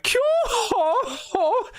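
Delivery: slow, very high villain cackle; Scarlet's cackle. Acted. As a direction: crow "kyo ho ho" in falsetto. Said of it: "Scarlet being like".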